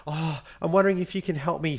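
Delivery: high-pitched mock plea for help; imitating stressed mother. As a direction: act the flustered mum on the phone. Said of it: "she's creating all this stress".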